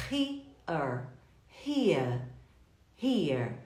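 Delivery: "here" with an audible breathy H at the start; H pronounced. Shown as a demonstration. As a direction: sound the H in "here" like a Portuguese initial R. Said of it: "O H da língua inglesa é o nosso H".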